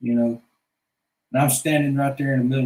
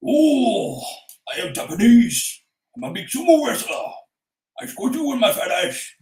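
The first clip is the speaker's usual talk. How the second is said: mock Japanese accent; 5 semitones higher, 3 dB louder than usual. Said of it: "supposed to be a Japanese sumo wrestler".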